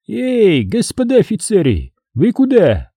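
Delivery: high-pitched hail; high, carrying challenge; calling after. A narrator's reading. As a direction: call out to men walking away. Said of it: "донеслось нам вслед".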